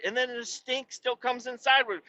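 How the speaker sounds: ordinary delivery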